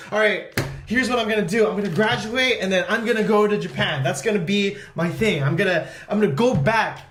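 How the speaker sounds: resolved excitement. Retold or imitated. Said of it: "I'm just like".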